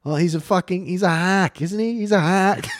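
dismissive put-on British; British hotshot actor. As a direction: play a hammed-up British star. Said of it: "a big hotshot actor in, uh, Liver Britain".

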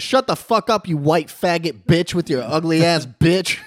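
high, loud imitation of her screaming abuse; retold screamed tirade, drawn out at the end; sudden furious outburst. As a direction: go from nothing to full mock rage. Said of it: "Like zero to sixty in a second".

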